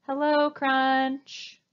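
a slow, too-loud hello. Shown as a demonstration. Teacher demoing a hello that scares Crunch, loud. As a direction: greet him loudly and slowly. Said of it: "you've said it too loudly".

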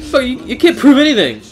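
high loud paranoid addict; hammed-up guilty denial, loud and high; defensive junkie. Mock drug addict denial.

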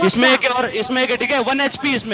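high-pitched, excited enemy report; urgent callout. Real, not acted. Player telling squad one enemy is nearly down, urgent callout.

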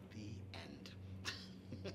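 soft whisper to close the story; whispered ending. A narrator's reading. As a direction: hush it like a bedtime end.

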